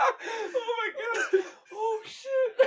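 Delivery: high, wheezing laugh-cry; can't breathe from laughing; real helpless laughter. Real, not acted.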